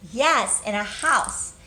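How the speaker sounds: ordinary delivery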